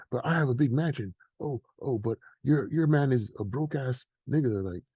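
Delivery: usual speaking voice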